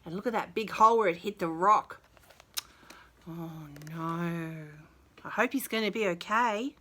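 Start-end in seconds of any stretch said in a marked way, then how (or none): none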